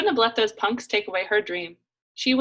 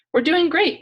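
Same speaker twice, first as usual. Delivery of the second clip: bright, high and loud saleswoman cheer; Macy's voice; 10 semitones higher, 9 dB louder than usual. Acted. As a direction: sell it like a store clerk, much higher and louder. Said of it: "I could hear her Macy's voice coming on".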